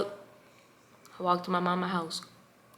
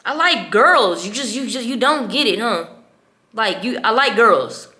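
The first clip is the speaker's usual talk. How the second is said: retold exasperated outburst; 4 semitones higher, 10 dB louder than usual. Daughter coming out to her father, exasperated retold outburst. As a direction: hammer it home to someone not getting it.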